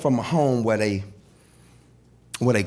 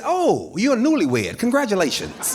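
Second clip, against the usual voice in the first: high, mocking congratulations; condescending old man; 8 semitones higher than usual. Old civil rights man teasing the young comic, condescending. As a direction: pat him on the head with it. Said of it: "he was very condescending".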